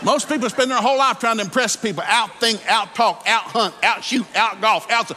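high, loud, ringing preacher's climax; preaching at full force. Acted. Preacher to congregation on being accepted by God, full-force preaching. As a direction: drive it home to the crowd.

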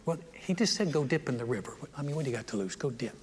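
soft, low, understated persuasion; gentle coaxing. Acted. Servant talking his master into dipping, gently coaxing. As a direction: suggest it quietly, as if no big deal.

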